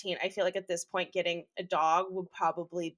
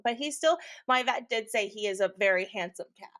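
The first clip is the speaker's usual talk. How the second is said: ordinary talk, nothing marked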